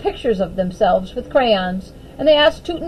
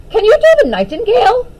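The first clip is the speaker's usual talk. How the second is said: eager question; 11 semitones higher, 5 dB louder, faster than usual. A narrator's reading. Eager request from Daphne.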